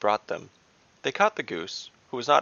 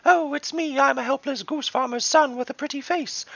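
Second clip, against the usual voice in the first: much higher, mock-innocent piping voice; hammed-up sweet helpless halfling; helpless little halfling. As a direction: play the pitiful farm boy to lure him. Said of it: "I played a wonderful, helpless little halfling".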